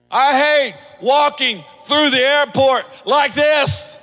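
high, loud, slow shouted point; preacher's shout. Acted. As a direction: shout it out over the crowd. Preaching shout about heavy baggage.

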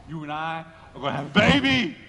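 put-on low lead-in bursting loud on "baby"; excited announcement. Abraham breaking the news to Sarah, excited announcement. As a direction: build to an astonished cry of "baby".

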